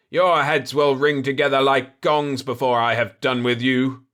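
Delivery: loud, booming furious threat. Acted. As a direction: roar it at them. Enraged giant threatens.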